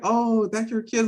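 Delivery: high, slow cooing lady; ladies' voice. Retold or imitated. Lady at the counter coos over the kids. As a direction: coo like an impressed stranger. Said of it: "the ladies would be like".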